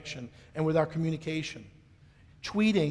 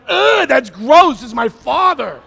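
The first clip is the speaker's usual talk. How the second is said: loud, slow, disgusted yell, higher; disgusted teen; 5 semitones higher, 12 dB louder, slower than usual. Retold or imitated. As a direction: shout it out like a grossed-out teenage daughter. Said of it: "My daughter went".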